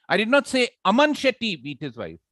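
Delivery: single out the name "Aman Shetty"; stress on AMAN SHETTY. Shown as a demonstration. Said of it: "That means somebody else".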